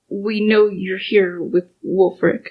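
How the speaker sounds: ordinary delivery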